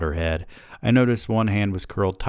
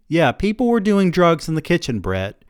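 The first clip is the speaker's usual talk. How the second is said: hip weary mode; 11 semitones higher, 6 dB louder than usual. A narrator's reading. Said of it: "recited this line in her hip wari mode".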